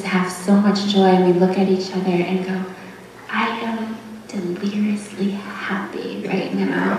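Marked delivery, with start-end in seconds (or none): 3.3-7.0 breathy whisper of happiness; whispered joy. Retold or imitated. Mother and daughter sharing joy, whispered. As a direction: whisper it like a shared secret. Shared joyful moment with mom.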